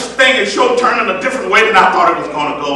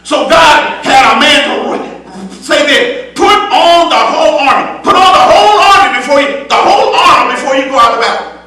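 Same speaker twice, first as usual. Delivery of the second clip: full-voiced preaching with rising fervor; 7 semitones higher, 5 dB louder than usual. Preacher exhorting the congregation in full preaching voice. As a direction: preach it out to the church.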